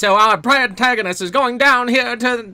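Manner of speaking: put-on high, loud old-timey announcer voice; 1950s narrator. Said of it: "does the announcer have the nineteen fifties kind of voice".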